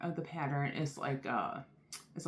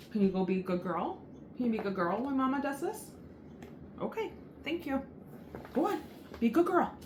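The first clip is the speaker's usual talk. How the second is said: much higher, coaxing pet voice; 10 semitones higher, faster than usual. Real, not acted. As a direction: sweet-talk the dog, pitched way up. Coaxing the dog to behave.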